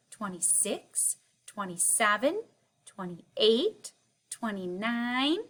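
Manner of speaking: kindergarten teacher voice. Acted. Counting aloud with class.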